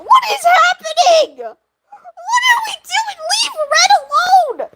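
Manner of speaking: high, drawn-out wail of protest; playful outcry. Real, not acted. Streamer defending Red from Thunder, high wailing protest.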